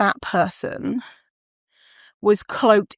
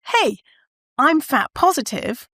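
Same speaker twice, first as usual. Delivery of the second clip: lightly voiced higher-pitched announcement; a mild mock proclamation, pitched up; fat-positive declaration; 6 semitones higher than usual. Imagined celeb declaring their stance to fans, mock proclamation.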